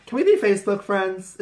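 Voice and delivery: high, eager chirpy question; bright friendly ask. Retold or imitated. To a new girl she met, a high eager ask. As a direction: pitch it way up, sweet and friendly. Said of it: "my first question is always like".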